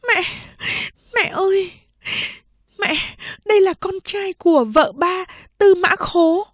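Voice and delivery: high, tearful daughter's plea. Acted. Second sister tearfully telling mother whose baby it is. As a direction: confess through tears. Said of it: "nước mắt chị chảy trên khuôn mặt".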